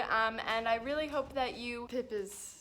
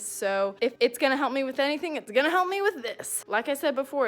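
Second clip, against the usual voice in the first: excited; 3 semitones higher, 8 dB louder than usual. Real, not acted. Hyped commentary.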